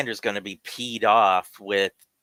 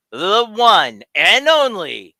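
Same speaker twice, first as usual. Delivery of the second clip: big booming guest introduction; announcer hype; 6 semitones higher, 5 dB louder than usual. Acted. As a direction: build up the name, land it loud. Hyping the special guest entrance.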